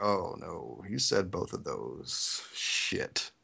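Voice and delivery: soft, slow, dropping to a hush; dawning dismay. Retold or imitated. Reporter hearing the recording again, hushed dismay. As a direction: realize the mistake under your breath. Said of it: "it was like".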